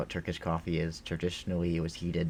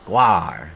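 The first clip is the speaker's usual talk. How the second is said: loud mock monster growl-shout; monster roar; 10 dB louder than usual. Streamer playing the goblin boss, loud roar. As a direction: let out a short, loud pretend roar.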